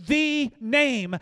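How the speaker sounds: loud, high "the" pronounced "thee"; stress on THE. Shown as a demonstration. Preacher quoting the verse with Greek emphasis, "the" stressed as "thee". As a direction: set "the" apart as an emphatic "thee". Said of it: "In the Greek, it emphasizes the name".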